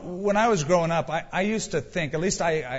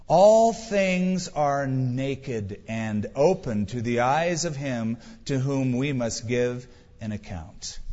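slow solemn reading; 2 semitones lower, slower than usual. Acted. Preacher reads scripture solemnly.

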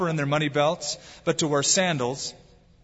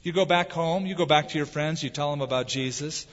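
usual speaking voice

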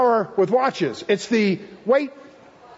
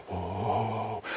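much lower, much softer letdown; deflated oh; 10 semitones lower, 11 dB quieter than usual. Acted. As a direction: let the word sink, caught out and deflated. God's deflated reaction.

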